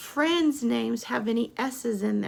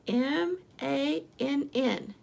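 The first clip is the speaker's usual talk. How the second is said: usual speaking voice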